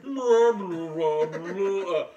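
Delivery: loud honking imitation of a crushed tuba; mock tuba blare. Comparing the accent to a run-over tuba, loud imitated honk. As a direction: blare like a tuba being flattened.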